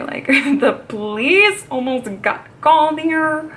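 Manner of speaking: slow, drawn-out disbelief with a laugh; re-enacted amazement, dragged out for effect; incredulous retelling. Telling dad the unbelievable news.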